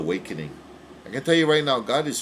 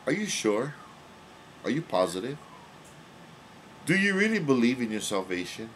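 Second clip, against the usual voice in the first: ordinary delivery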